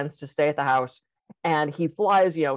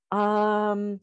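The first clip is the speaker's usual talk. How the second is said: slow, careful, halting delivery; hesitant punchline; 3 semitones higher, much slower than usual. Acted. Friend breaking news gently to the vacationer, slow halting delivery.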